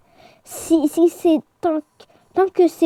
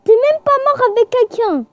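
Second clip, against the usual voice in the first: high-pitched indignant reproach; exasperated scolding; 8 semitones higher than usual. Real, not acted. Player scolding a friend for a silly death, exasperated scolding. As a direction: tell them off in disbelief. Berates friend who died.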